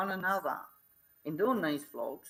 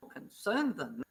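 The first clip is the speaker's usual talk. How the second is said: normal talking voice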